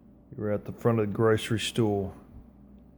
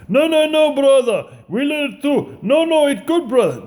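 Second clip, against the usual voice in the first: high, loud mock Turkish coworker insisting; 13 semitones higher, 6 dB louder than usual. The Turkish coworker dismissing the cracked parts, high and pushy. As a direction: brush him off, high and pushy, calling him brother. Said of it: "a Turkish guy I used to work with".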